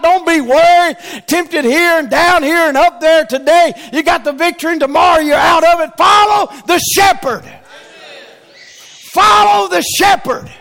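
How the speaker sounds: loud, high preaching cry; fiery preaching. Real, not acted. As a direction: preach it out with full force. Preaching at full force.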